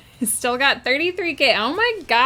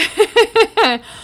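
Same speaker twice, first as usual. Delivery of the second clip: high burst of laughter; 11 semitones higher, 7 dB louder than usual. Real, not acted. Reacts to chat redeem.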